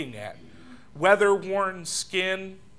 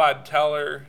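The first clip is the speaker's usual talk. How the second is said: normal talking voice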